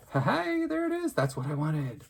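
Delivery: high, loud, triumphant burst of delight; delighted cheer. Real, not acted. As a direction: celebrate getting exactly the result you wanted. Celebrating that the technique works.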